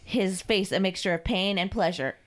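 ordinary delivery, nothing marked

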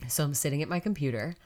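ordinary talk

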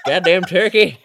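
loud, high pretend outrage with laughter; mock cursing. Friend imagines cursing turkeys from the car, mock angry. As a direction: shake your fist in mock fury. Acting out fist-shaking at turkeys.

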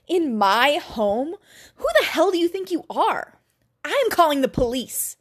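angry, high-pitched outrage; indignant and threatening. A narrator's reading. Man threatens intruder angrily.

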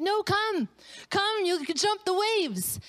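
high, drawn-out coaxing call; urging dad. Retold or imitated. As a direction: imitate a dad calling his child on. Dad urging reluctant daughter on.

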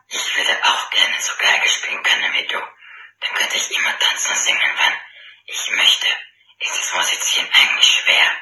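low, growling greedy bear; deep bear growl. Acted. Bear asks. (tiefe Brummstimme eines Bären)

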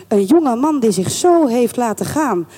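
plain reading voice

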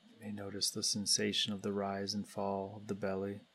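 calm, unhurried guidance with long pauses between phrases; guided meditation. Real, not acted. Meditation leader guiding everybody to turn attention inward, calm and spacious. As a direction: lead the group gently inward, leaving space after each phrase.

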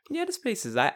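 high noncommittal reply; imitating lukewarm approval; underselling. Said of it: "I'm still un".

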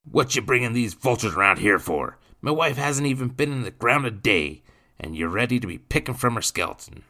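loud, high indignant accusation; angry common-man character voice; surly drunkard. Acted. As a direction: berate the intruders as an aggrieved drunk.

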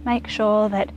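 gentle guided relaxation over music; guided meditation. Acted. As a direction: ease the listener into calm, softly. Leads a meditation.

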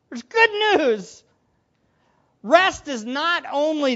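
loud, high, fervent preaching; excited preacher calling to the congregation. Acted. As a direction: lift the room with good news. Preaching at full force.

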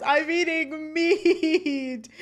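high, loud, drawn-out mock cry; mock wailed confession. Imitating Corey confessing to Sean, hammed-up drawn-out wail. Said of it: "he relents and cries".

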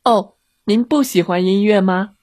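high, mock-innocent question; feigned innocence. A narrator's reading. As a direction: ask sweetly as if you had no idea. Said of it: "小红帽假装天真地问".

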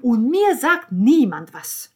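indignant outburst. A narrator's reading. Niccolò protests being left out, indignantly loud. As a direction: complain in hurt indignation.

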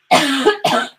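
short real coughing fit. Real, not acted. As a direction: cough between sentences. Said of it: "Coughing now".

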